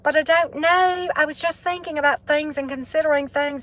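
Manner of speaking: high, sing-song British accent put on by a Southern speaker; a Southern woman's hammed-up British accent; Southern take on a British accent. Shown as a demonstration. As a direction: play a chatty Brit over tea, high and lilting. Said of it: "doing the Southern, Southern United States version of a British accent".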